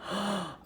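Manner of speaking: soft mock gasp; shocked gasp. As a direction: draw in a short breath as if appalled. Retold reaction to the blame.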